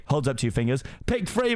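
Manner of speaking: plain reading voice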